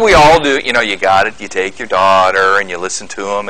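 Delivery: ordinary talk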